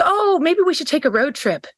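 high, upbeat imitated suggestion; staying positive. Bumped passenger proposing a road trip, positive imitation. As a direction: imitate an unflappable optimist having an idea. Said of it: "just stayed positive".

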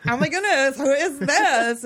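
put-on loud, high Valley Girl gush, dragging out "who is this"; eighties Valley Girl. Teen gushing over a yearbook photo, exaggerated Valley Girl. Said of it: "my best eighties Valley Girl voice".

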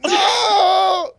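high, loud movie-hero shout with laughter; mock anguished shout. Mocking the Armageddon hero, hammed-up high shout. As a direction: scream it like a hammed-up doomed hero. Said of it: "he was like".

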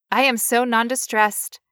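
measured, stilted robot. Acted. Patient claiming to be non-distressed, robotic voice. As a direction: recite it like an emotionless machine. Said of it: "I'll try it again as a robot".

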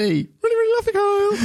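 playful declaration rising very high; mock affection. Answering Kyle's second hashtag, mock affection. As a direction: climb into a high, gushing squeak.

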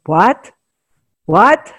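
put-on rising, loud Russian-accent "What?". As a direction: bark the word in a Russian accent. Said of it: "he kept saying to the lady in the Russian accent".

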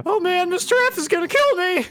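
very high, loud panicky wail; panicked robed guy. Acted. Robed guy fearing his boss, high panicked wail. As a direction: squeal it like he's doomed. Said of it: "the guy in the robes would be like".

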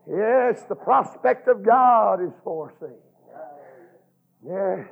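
ordinary delivery

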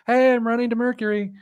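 high, cheerful, carefree voice; high breezy wife. Retold or imitated. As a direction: announce it brightly and high. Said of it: "She's like".

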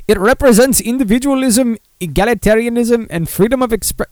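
high-pitched fake Russian accent, falling apart; comic Russian accent drifting toward Indian; Yakov Smirnoff. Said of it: "Wow, I'm completely losing it".

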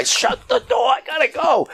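very high frantic yell, acted out; high-pitched urgent shouting from the story; retold yelling. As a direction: re-enact a shout in a big hurry. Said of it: "So I started yelling".

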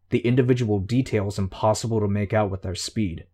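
usual speaking voice

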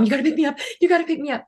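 put-on high, urgent pleading of a scared kid; frantic panic. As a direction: beg to be picked up, panicked. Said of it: "in a frantic panic".

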